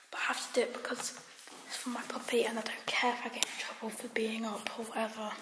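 hushed, frightened whisper; scared whisper. Real, not acted. Girl sneaking upstairs at night, whispering scared to viewers. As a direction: whisper so the parents don't wake.

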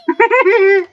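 high, loud giggle after a scare; helpless laugh. Real, not acted.

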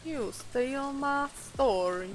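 soft, slow southern drawl; quiet, badly faked southern accent, vowels drawn out; slow southern-accent movie line, held back; attempted southern accent. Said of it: "It's not a good southern accent".

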